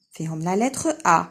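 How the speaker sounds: normal talking voice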